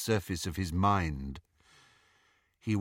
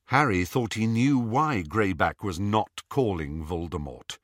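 plain reading voice

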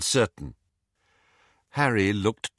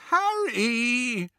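high, drawn-out wail; 14 semitones higher than usual. A narrator's reading. Hermione to Harry after Lupin leaves, high wail. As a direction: wail his name in dismay. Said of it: "wailed Hermione".

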